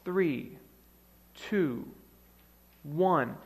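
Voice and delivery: slow, spaced-out countdown. Acted. Preacher counting down to the big moment, slow countdown. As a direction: count down softly with long pauses between numbers. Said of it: "And the countdown continues".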